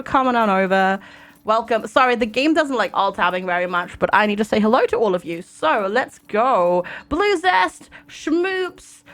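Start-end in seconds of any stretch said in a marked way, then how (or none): none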